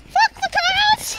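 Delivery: high, slow yelled defiance; defiant shout. Real, not acted. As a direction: yell it at the traffic.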